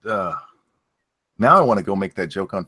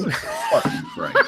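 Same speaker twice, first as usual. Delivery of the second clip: high, laughing disbelief; laughing exasperation. Real, not acted. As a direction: crack up in exasperation.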